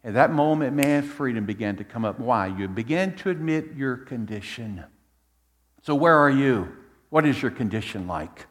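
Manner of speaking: usual speaking voice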